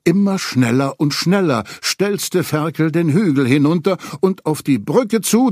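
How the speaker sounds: ordinary delivery, nothing marked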